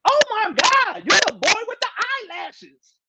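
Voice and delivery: light high squeal imitating a fan who spots him; mock excited woman. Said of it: "the sister just yelled out".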